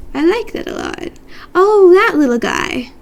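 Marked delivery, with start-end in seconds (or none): 1.5-2.9 high, slow, louder "aww" voice; cooing delight. Real, not acted. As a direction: coo over something adorable. Cooing at a cute picture.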